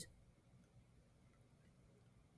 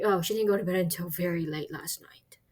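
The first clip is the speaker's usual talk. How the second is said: whisper; 2 semitones lower, 6 dB quieter than usual. Pretend. Acted friend answering a question.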